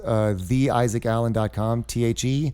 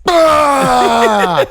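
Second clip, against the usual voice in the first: sudden loud high scream; loud, high haunted-house scare shout; jump scare yell; 12 semitones higher, 12 dB louder than usual. Retold or imitated. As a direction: burst out to scare the kids. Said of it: "my dad jumps off the thing and just goes".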